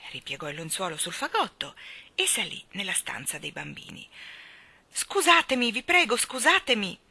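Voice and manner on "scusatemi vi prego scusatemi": high, loud urgent pleading; hurried apology. A narrator's reading. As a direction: push past with flustered apologies. Father rushes past up the stairs.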